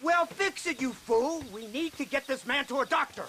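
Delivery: high, urgent orders; angry order. Acted. Boss berates driver as the car dies, urgent. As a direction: snap at the driver to get moving.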